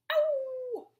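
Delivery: high, loud mock howl; wolf howl. Shown as a demonstration. Teacher comparing puppy and wolf, loud howl. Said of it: "Sounds kinda like the wolf".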